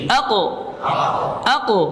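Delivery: Aku said with a half-O colour after the q; half O vowel. Shown as a demonstration. Teacher demonstrating the qaf vowel colour to students. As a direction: round the vowel toward O. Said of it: "kayak setengah O gitu".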